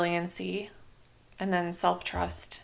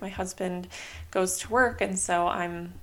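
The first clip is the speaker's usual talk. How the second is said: ordinary talk, nothing marked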